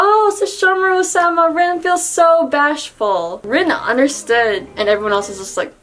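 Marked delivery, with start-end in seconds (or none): 0.0-3.4 high, gushing imitation of a smitten girl; bashful little Rin. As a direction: gush shyly, slowing and stretching the end. Acts out flustered, adoring Rin.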